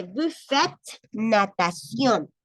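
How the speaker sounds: slow French "Vous faites natation"; French read aloud. Shown as a demonstration. Teacher reads the next French example aloud.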